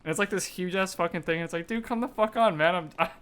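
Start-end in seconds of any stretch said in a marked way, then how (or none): 1.7-3.1 higher-pitched mock pleading; hurt protest. Voicing the rejected dude answering a tirade, mock-hurt protest. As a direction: whine it like you're wounded, half laughing.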